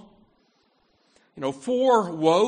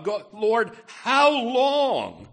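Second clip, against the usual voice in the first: crying out; 7 semitones higher, 5 dB louder, slower than usual. Retold or imitated. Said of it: "David is crying out to God".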